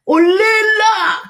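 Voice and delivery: high, long, stretched-out olela; mock them with a slow, high wail of olela; drawn-out mocking cry. (cri moqueur très aigu et lent)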